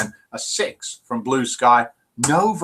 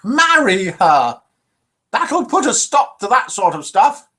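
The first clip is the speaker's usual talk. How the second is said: slow, high, loud old-timer voice with a bad accent; hammed-up accent, drawn out and raised; dreadful put-on accent; 9 semitones higher, 6 dB louder than usual. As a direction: deliver the punchline in a higher, slightly different accented voice. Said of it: "Dreadful accent there".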